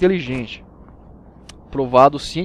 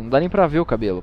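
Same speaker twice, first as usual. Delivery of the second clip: ordinary delivery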